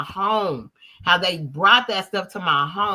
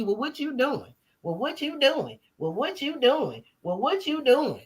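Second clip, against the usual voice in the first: repeated high mocking question; 5 semitones higher, 4 dB quieter than usual. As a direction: needle them with a put-on whiny ask. Mocking people asking her.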